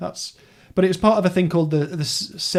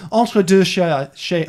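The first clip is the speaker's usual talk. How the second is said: slow, louder stab at a French accent; best French accent; 4 semitones higher, 5 dB louder, much slower than usual. Shown as a demonstration. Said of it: "let me try my best French accent".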